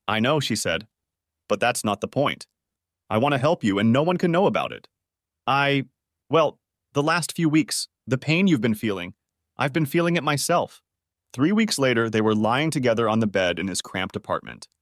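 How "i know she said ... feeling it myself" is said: ordinary delivery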